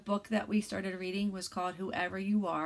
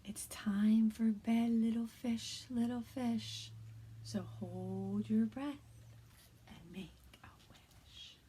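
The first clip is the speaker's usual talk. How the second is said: soft, hushed read-aloud; quiet bedtime reading; 2 semitones higher, 4 dB quieter than usual. Shown as a demonstration. Teacher reads a bedtime story to kindergarteners, hushed. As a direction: lull little ones toward sleep. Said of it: "I'm gonna read this book a little quieter".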